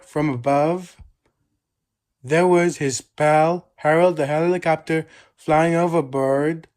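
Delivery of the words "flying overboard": plain reading voice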